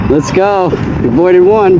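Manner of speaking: loud relieved cheer; excited. Real, not acted. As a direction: celebrate getting past. Relief after avoiding cop.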